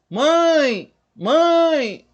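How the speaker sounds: "Mãe" high, loud and drawn out; prolonged vowel. Shown as a demonstration. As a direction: hold the vowel of "Mãe" as a teaching example. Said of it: "se você prolongar a palavra".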